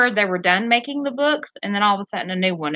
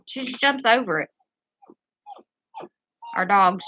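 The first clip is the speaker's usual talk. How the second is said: ordinary talk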